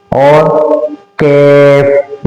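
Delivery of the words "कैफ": कैफ with the long vowel flattened to ae; wrong fronted vowel. Shown as a demonstration. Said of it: "इस तरह से नहीं कहेंगे".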